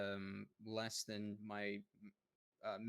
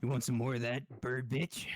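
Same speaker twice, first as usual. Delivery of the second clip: loud taunt; 2 semitones higher, 7 dB louder than usual. Real, not acted. Taunting the monster after shooting.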